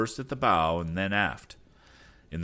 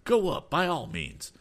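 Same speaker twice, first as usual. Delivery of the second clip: hammed-up hungry exclamation; eager cry; 10 semitones higher, 3 dB louder than usual. Mister Damon continues.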